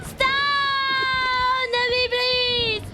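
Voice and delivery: high, drawn-out desperate wail; panicked pleading. Real, not acted. Begging game attacker to stop.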